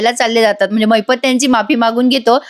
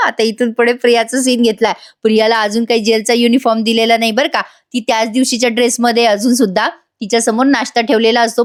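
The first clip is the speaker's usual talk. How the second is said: plain reading voice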